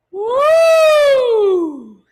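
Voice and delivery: loud, very high siren swoop; big swooping warm-up whoop. Shown as a demonstration. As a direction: push the siren up high and slide down loudly. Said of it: "And a little bit higher".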